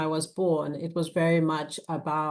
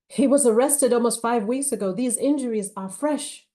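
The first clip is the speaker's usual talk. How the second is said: raised, high-pitched accusation; indignant challenge; 6 semitones higher, 6 dB louder than usual. A narrator's reading. Lawyer confronting officer about client's injuries, indignant. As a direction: challenge him, pitched up and insistent.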